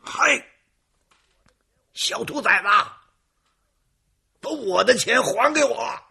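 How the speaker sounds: roaring at the top of lungs; furious shout. Acted. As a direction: bellow it in a rage. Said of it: "大喝一声". (怒发冲冠地大喝)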